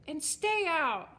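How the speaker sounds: angry shrub yelling. A narrator's reading. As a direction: holler it slowly to send someone off. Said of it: "yelled the shrub".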